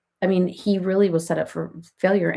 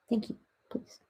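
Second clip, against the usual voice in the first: soft unvoiced whisper, much softer; whisper to the cat; 2 semitones higher, 12 dB quieter than usual. Real, not acted. Asking the cat nicely, in a soft whisper. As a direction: breathe it to the cat.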